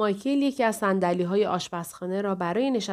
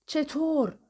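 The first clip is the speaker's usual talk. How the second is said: plain reading voice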